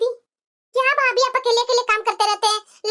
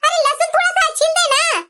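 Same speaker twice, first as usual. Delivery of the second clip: exasperated daughter; 4 semitones higher than usual. Acted. Daughter exasperated at her mother's complaints, whiny plea. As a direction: plead and scold mom at once.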